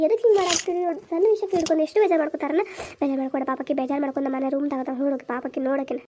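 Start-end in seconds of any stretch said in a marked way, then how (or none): 3.0-6.0 low, hushed motherly sympathy; gentle, subdued consoling; soft soothing. Acted. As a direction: lower the voice to comfort a son. Mother soothes upset son.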